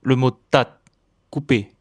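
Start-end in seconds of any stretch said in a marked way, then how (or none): 0.3-0.8 "tat" with a stopped final t; Thai word. Shown as a demonstration. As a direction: cut "tat" off sharply. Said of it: "Voyons quelques exemples".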